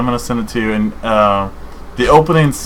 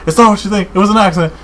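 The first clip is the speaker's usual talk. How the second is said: much higher, lightly voiced pleading man; mock-frantic excuse, pitched way up; panicked boyfriend; 9 semitones higher, much faster than usual. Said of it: "he goes".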